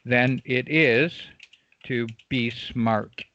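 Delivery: slow, spaced-out words; measured writing-along pace; writing while speaking. Real, not acted. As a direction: finish dictating the motto slowly. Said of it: "I'm gonna write something out here".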